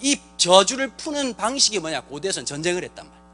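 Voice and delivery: ordinary talk, nothing marked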